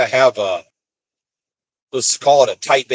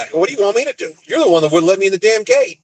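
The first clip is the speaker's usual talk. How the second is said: higher-pitched, exasperated comeback; indignant retort; 8 semitones higher, faster than usual. Retold or imitated. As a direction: argue back, retelling the quarrel. Retold argument with gate guard.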